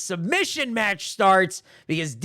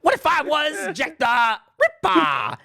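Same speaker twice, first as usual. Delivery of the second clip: higher, slow hammed-up shout; mock shout; 9 semitones higher, slower than usual. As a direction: bellow it out like a rap hook. Mocking the rap line.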